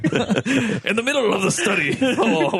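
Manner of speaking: laughing. Pretend. Laughing through narration.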